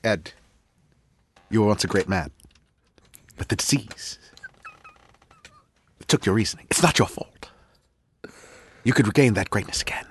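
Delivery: hushed dramatic whisper. Pretend. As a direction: breathe it like a dying captain. Dramatic Star Trek pep talk.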